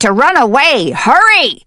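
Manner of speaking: high, loud cry of warning; urgent yell. Acted. Hidden voice to trapped hen, urgent yell. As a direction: yell it to rush someone away.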